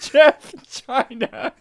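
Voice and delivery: slow, high, laughing repeat; savoring the silly name through laughter. Real, not acted.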